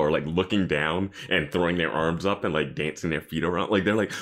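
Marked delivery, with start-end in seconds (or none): none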